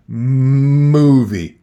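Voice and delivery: "Movie" loud with a long humming M; M stressed. Shown as a demonstration. Teacher stressing the M in "Movie" so pupils hear it. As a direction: lean on the M in "Movie". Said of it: "Can you hear the letter M".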